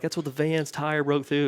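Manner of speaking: high, loud, thrilled discovery; like you just cracked a secret; excited realization. Retold or imitated.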